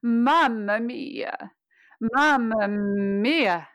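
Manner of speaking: full, focused mia at the end of Mamma mia; don't let mia fade, keep it as strong as Mamma; exaggerated final syllable. Shown as a demonstration. Coach exaggerates the final syllable. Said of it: "I'll exaggerate".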